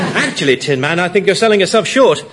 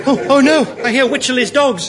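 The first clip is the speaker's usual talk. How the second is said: alarmed; 6 semitones higher than usual. Acted. Captain hearing pursuing dogs, alarmed. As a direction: sound the alarm, pitched right up.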